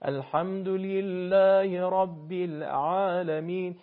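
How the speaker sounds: slow recitation, the ع in العالمین slightly stretched. Shown as a demonstration. Teacher showing the stretched ع: slow recitation. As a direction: let العالمین glide as if the letter pulls out. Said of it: "انگار حرف کش میاد".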